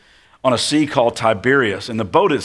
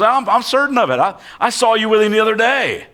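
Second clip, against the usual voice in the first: much higher, sure of himself; insistent accuser; 11 semitones higher, 4 dB louder than usual. Acted. Second bystander insisting he saw Peter, high pushy voice. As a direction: press the charge home.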